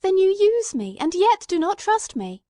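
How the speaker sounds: high, loud, hurt protest; bitter cry. Acted. Watson reproaching Holmes for deceiving him, loud bitter cry. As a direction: let the betrayal sting in it. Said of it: "I cried with some bitterness".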